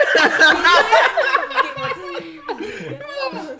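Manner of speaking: long high burst of laughter; high delighted laugh. Real, not acted. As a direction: laugh out loud, thrilled. Reaction to job offer.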